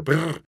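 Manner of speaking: loud rolled-lip brrr; shuddering brrr. A narrator's reading. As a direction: shudder noisily in distaste. Mother shudders at sea.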